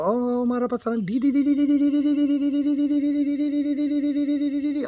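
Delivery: put-on high monotone child-monk chant; imitating little monks. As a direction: imitate young monks chanting the last syllable on one breath. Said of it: "you hear them go".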